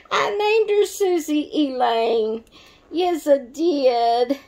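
high, sing-song and teasing; playful after fixing her slip of the name; playful singsong. Real, not acted. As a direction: announce it proudly, then a slow teasing yes I did. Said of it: "Sorry, Susie".

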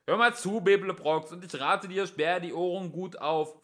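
policeman's holler; shouted warning. A narrator's reading. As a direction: demand attention from across the way. Policeman shouts across to fugitives.

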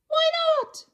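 high, bright cheering call; cheery cry. Acted. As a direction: cheer him on loudly. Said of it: "cried the Ruse with a super loud cheer".

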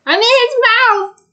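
high, loud alarmed yell; shocked shout. Real, not acted. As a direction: cry out in surprise. Swallowed by the monster in game.

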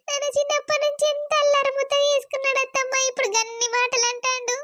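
high tearful wail to an elder; wailing, hurt retelling; crying. Acted. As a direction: plead through sobs, pitch climbing steadily. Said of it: "ఊకే ఏడవకు".

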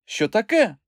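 high-pitched, puzzled woman's voice; startled question. A narrator's reading. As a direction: ask it high, taken aback. Startled by the sudden order.

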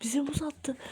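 very soft, secretive commentary; hushed whispering. Pretend. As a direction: murmur while spying from hiding. Spying secretly on the intruder.

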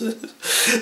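short airless chuckle; soft breathy chuckle. Real, not acted. Storyteller chuckling at his own punchline, soft breathy laugh. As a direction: chuckle under the breath at the joke.

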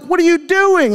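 a dad walking into chaos; exasperated parent. Retold or imitated. Father confronting his kids, high exasperated disbelief. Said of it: "And I'm like".

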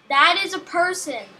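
ordinary talk, nothing marked

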